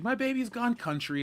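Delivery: high-pitched mock-mom exclamation; mom's retold outcry, pitched high; lightly voiced mom. Retelling mom's reaction to singing.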